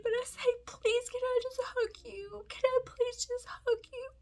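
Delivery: high, voice breaking with tears; crying. Real, not acted. Mom asking the stranger for a hug, crying. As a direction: plead through sobs. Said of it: "that's not why I'm crying".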